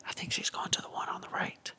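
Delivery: worried whisper; hushed warning over the line. Retold or imitated. Teammate whispers that she's going the wrong way.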